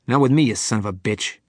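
loud spiteful voice; angry curse. Acted. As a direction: spit the insult. Another prisoner cursing Billy.

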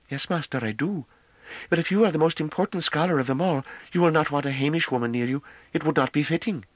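put-on high, meek servant girl; sadly humble, pitched high; humble young woman voice. A narrator's reading. Novi's sad humble reply.

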